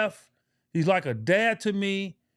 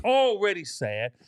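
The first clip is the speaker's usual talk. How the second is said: ordinary talk, nothing marked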